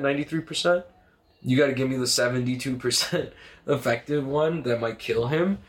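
high-pitched retold rant; mock furious rant. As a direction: rant at him, half laughing. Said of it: "I started getting mad".

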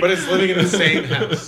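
real amused outburst; laughing objection. Real, not acted. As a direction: crack up while pointing out the absurdity. Joking objection amid shared laughter.

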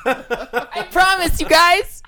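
very high, drawn-out squeal; high-pitched insistence. Real, not acted. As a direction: insist in a squeaky, laughing plea. Insisting amid shared laughter.

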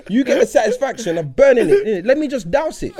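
giggly, pushy bargaining; laughing plea. Retold or imitated. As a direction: coax him while cracking up. Pushing mate to light it.

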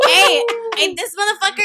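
excited. Real, not acted. Excited retelling.

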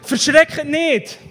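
slow, high, loud call; commanding call. Acted. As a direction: call it out over a storm. Calls out Jesus' words in storm. (laut und gedehnt gerufen)

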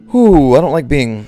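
ordinary talk

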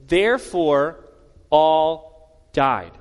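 slow, heavy, with a pause after Therefore; slow deliberate reading. Shown as a demonstration. Preacher rereading the verse's ending slowly, pausing, deliberate. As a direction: weigh each word, pause before all died. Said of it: "I want to read that again slowly".

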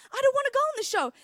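put-on high, loud, fast kid whine; whiny protest. Daughter loudly whining at dad about the show. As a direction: wail it like a kid refusing.

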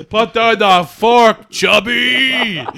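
loud, slow jeering shout; mock shout. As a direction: bark it like a bully. Mock insult yelled at friend.

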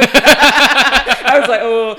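laughing through the words; giggling, loud and slow. Retold or imitated. Recalls reacting to the mage.

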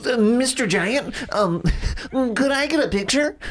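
high timid fan voice; squeaky polite fan. Retold or imitated. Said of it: "a fan coming up to a man and be like".

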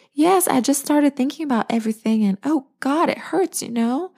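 high, strained voice of hurt; pained and shaken. Acted. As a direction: let the pain crack through, rising high. Larkin hurt and overwhelmed.

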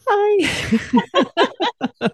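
very high, loud, laughing "Bye"; breezy dismissal. Pretend. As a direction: wave off the rude man cheerfully. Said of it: "I'd be like".

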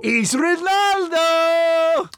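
high, drawn-out Portuguese "Ronaldo!"; Portuguese accent. Pretend. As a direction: belt "Ronaldo!" slowly, accented. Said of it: "do a Portuguese accent".

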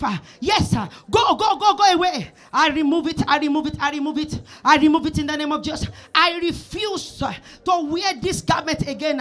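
fervent prayer. Acted. Loud prayer of removal.

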